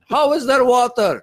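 high, loud, outraged disbelief; hammed-up bewildered neighbor shouting; baffled Armenian neighbor. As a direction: yell up like a furious old man who can't work it out. Reprising the neighbor's line.

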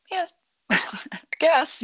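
high, slow, laughing through the words; laughing sheepishly. Real, not acted. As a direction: giggle out a sheepish yes. Wife answers husband, sheepish.

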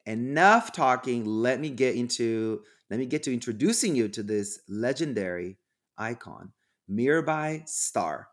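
ordinary talk

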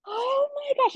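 high, thrilled oh my gosh; excited squeal. Retold or imitated. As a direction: squeal it out in disbelief. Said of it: "And I was like".

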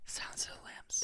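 breathy whispered answer; whispering. Real, not acted. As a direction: whisper the title.

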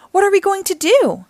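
high, anxious asking; worried question. A narrator's reading. Donnie asks about refueling, worried question. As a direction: ask it high, at a loss.

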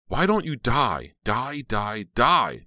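high, loud, drawn-out curse; venomous outburst. Acted. Hateful words repeat in Heather's mind, loud venomous outburst. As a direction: hurl it slowly, loud and high.

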